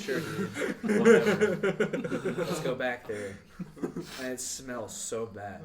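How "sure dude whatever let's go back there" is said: sarcastic, laughing, slow whatever; laughing sarcasm. Retold or imitated. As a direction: scoff through laughter.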